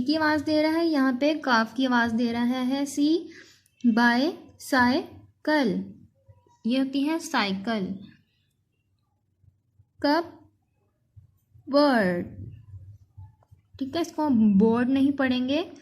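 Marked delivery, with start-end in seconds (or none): 3.9-5.8 बाय, साय, कल slowly, a pause after each; three slow separate beats: बाय, साय, कल; read part by part. Shown as a demonstration. Teacher repeating the parts of cycle, slow and separated. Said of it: "इसको ऐसे पढ़ेंगे". 10.0-12.3 cupboard as "कप", long pause, "bird"; split into syllables. Shown as a demonstration. Teacher showing how to spell, cupboard split in two. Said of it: "कप इसको पढ़ लें".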